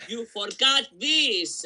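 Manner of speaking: high, loud mock protest; hammed-up uncle objecting; uncle correcting. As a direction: bark out a correction like a fussy uncle. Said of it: "would come in and be like".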